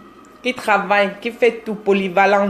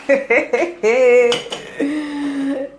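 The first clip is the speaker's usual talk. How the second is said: high laugh; 5 semitones higher than usual. Real, not acted. Live host mocking a tiny dowry offer, high laugh. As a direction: laugh out high, drawing out the end.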